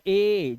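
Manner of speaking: ए held long; lengthened, sustained ए; long "ay" sound. Shown as a demonstration. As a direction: draw ए out as "ayyy". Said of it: "आवाज लंबा जाना".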